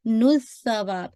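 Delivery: slow demo. Shown as a demonstration. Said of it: "सा है तो वाज़े कीजिएगा".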